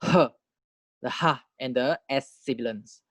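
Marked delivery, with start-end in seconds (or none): 0.0-0.3 short forceful puff of "h"; isolated airy "h"; H sound demo. Shown as a demonstration. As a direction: breathe out a sharp "h". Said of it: "you have the".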